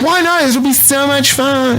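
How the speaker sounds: put-on high, bubbly college girl voice; mock a perky freshman girl; young girl. Veteran imitating a peppy nineteen-year-old classmate. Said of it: "They're like".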